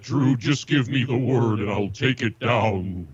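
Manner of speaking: ordinary talk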